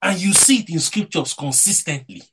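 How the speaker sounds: rising, fervent preacher's voice with loud peaks; preaching. Acted. Pastor exhorting the brethren about Luke twenty-three, fervent preaching. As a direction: preach it to the congregation with conviction. Preacher delivering his sermon.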